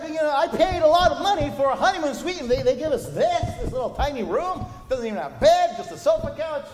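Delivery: loud, high indignant shouting at the manager; furious groom yelling; angry complaint. Retold or imitated. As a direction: rant that you paid for a honeymoon suite. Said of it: "He was angry".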